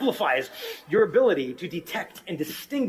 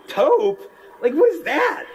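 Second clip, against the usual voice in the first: high-pitched, incredulous questioning; mock bafflement; 8 semitones higher, 4 dB louder than usual. Man baffled by women's fancy color names, mock disbelief. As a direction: puzzle over it, pitch climbing.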